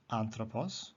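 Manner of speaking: accent the AN of "anthropos", softly; stress on first syllable. Shown as a demonstration. Teacher repeating an example, "anthropos" stressed on AN. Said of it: "the accent is on the third last syllable".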